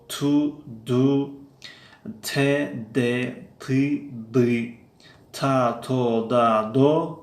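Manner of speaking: slow, clear, separate takes of تو and دو, with a voiceless ت in تو and a voiced د in دو; teacher's pronunciation drill. Shown as a demonstration. Teacher drilling viewers on Russian т and д, slow and clear. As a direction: sound each syllable deliberately, setting ت against د. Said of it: "گفتن فرقیت بین ت و د".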